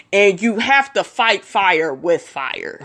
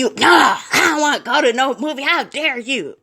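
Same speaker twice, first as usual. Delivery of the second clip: mock indignant boyfriend; 5 semitones higher than usual. Boyfriend refusing the movie invite, mock huff. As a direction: play the sulking man refusing the movies. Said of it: "And he".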